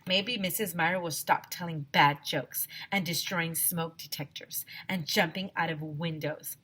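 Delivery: ordinary delivery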